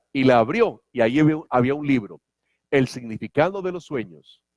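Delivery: ordinary talk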